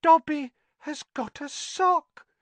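high squeaky voice, stunned; house elf in disbelief. Acted. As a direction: elf who can't believe it. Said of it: "said Dobby in disbelief".

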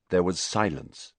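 ordinary delivery, nothing marked

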